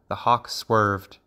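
usual speaking voice

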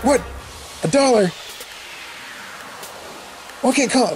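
usual speaking voice

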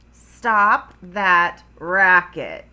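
loud, slow, each word separate; cross dad. Acted. Dad scolds Froggy about the saxophone, cross and loud. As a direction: order it forcefully, word by word.

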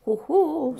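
loud, high oh oh oh; exclaiming. A narrator's reading. Doctor exclaiming at his patient's new belly, loud and high. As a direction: exclaim in delight. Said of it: "s'exclama-t-il".

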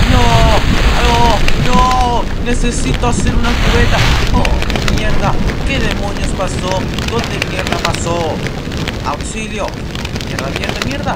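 loud high panicked shouts; panicked yelling. Real, not acted. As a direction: yell in real panic. Falls into lava, panics.

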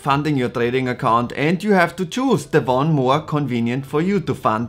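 put-on German accent. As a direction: imitate a scammer with a German accent. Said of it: "Maybe he has a German accent".

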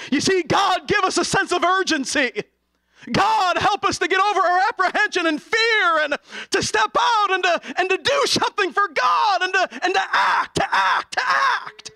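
preaching at full force. Acted. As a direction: urge them on, rising to a cry.

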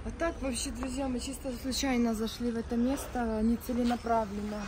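normal talking voice

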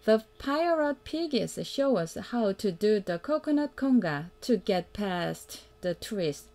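usual speaking voice

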